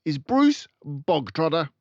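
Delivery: loud, high demand; furious shout. Acted. Headmistress calling the boy out, loud and fierce. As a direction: roar it at the whole hall.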